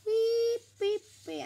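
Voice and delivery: squeaky robot beep beep beep; high loud beeps. Real, not acted. A girl drawing makes playful high loud beeps. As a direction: chirp them out high and loud.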